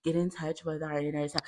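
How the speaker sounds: mock serene namaste guru; calm hippie voice. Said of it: "I'm the hippie of the house".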